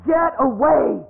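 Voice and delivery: panicked shout. Acted.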